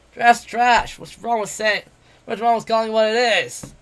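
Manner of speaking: brash, jeering tavern boaster; cocky werewolf adventurer. Acted. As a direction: taunt him like a swaggering bully. Bete voiced in the story.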